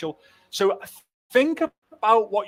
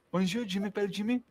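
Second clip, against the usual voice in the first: mock French; 4 semitones higher, 8 dB quieter than usual. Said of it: "It's turned into a French lesson".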